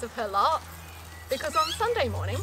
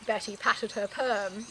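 plain reading voice

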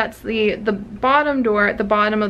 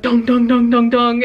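mock dong, dong of a metal door, a bit louder; ominous door knock; 5 dB louder than usual. Describing the knock on the metal door to viewers, in a mock ominous boom. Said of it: "this ominous, like".

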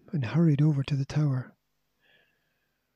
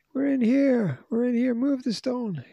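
much higher, urgent shout; yelling; 9 semitones higher than usual. A narrator's reading. Julian trapped, yelling to be let out, high urgent shout. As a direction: shout to rescuers through a wall. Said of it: "yelled Julian".

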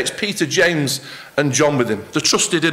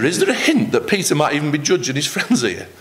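usual speaking voice

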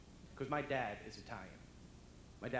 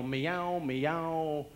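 loud, swaggering meows; Brooklyn cat; 10 dB louder, much slower than usual. Acted. Cat who thinks it's God's gift, cocky Brooklyn. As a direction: meow like a Brooklyn tough guy. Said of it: "every cat in the world has a Brooklyn attitude".

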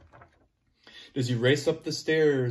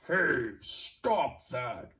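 slow firm growl; 3 semitones lower, much slower than usual. Acted. Dinosaur growls at bullies.

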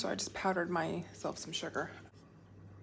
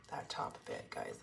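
whispering; 8 dB quieter than usual. Real, not acted. Savoring the baked topping.

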